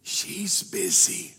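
put-on low, slow, hushed little kid; little boy's voice. Little boy on the phone to a salesman, hushed and slow. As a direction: say it slowly like a small boy covering for his parents. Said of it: "And the little voice said".

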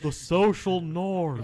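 slow, high, loud mimicry; drawn-out teasing imitation through laughter; mocking the odd voice. Player teasing a friend's odd voice, slow loud laughing mimicry. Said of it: "why is that my voice now".

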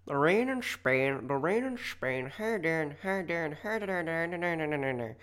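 rising Northern Irish accent drill, high; Northern Irish accent warm-up. Shown as a demonstration. Podcaster warming up her Northern Irish accent, sing-song. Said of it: "I need to get into it".

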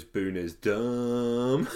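very slow, stretched out; drawn-out dumb. Retold or imitated. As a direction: draw out every vowel of dumb. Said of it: "so it goes".

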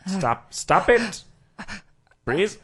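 sharp loud stop it. Acted. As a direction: snap it out loud. Scolding her for fighting.

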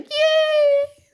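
high excited squeal of joy; excited cheer. Retold or imitated. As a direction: cheer it like a happy kid. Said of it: "I was like".

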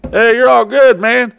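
high, loud shout from outside the bus; calling out loudly. Retold or imitated. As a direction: yell it across the parking lot, reassuring.

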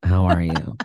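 low, flat-affect greeting; a hint of a bored deadpan hello; imitating her deadpan hello; Aubrey Plaza deadpan. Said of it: "you have her affect".

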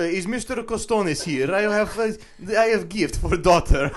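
high, loud, hammed-up Greek-ish suitor voice, laughing through it; a bumbling Greek suitor asking for the daughter; mock Greek accent. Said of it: "I don't think that's a Greek accent".

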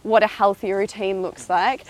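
ordinary talk

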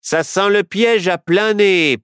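slow, sneering sheriff. A narrator's reading. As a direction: sneer it out slowly. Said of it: "persifla le shérif".

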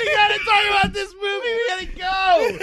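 very high, impatient shout; exasperated yell. Real, not acted. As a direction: urge a rambling friend onward at the top of your voice. Yells at co-host to move on.

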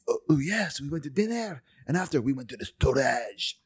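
hammed-up bad French-accented English; mock French accent. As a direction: imitate an actor faking French, lightly. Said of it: "they'll be saying stuff like".

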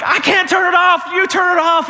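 high, strained comic yell; frantic retort. Retold or imitated. Dad to daughter holding the hose, acted frantic yell. Dad yells back in flood.

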